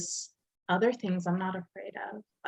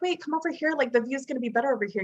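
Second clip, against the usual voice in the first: eager coaxing; 6 semitones higher, faster than usual. Retold or imitated. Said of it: "And I'm like".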